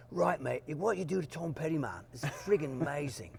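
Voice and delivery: put-on a Londoner checking his own English accent. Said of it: "how does my English accent".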